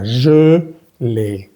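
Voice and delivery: "Je" closed and rounded, "l'ai" opened to é; slow, split syllables in "Je l'ai", closed then open; closed then open vowel. Shown as a demonstration. Teacher coaches mouth shape, "Je" closed, "l'ai" open. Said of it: "Cierras la boca, abres la boca".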